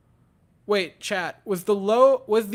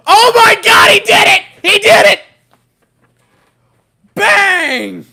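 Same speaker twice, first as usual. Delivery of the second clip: real ecstatic screaming, much higher; wrestling commentator; 14 semitones higher, 18 dB louder than usual. Real, not acted. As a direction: scream it like a championship call. Reliever strikes out star hitter.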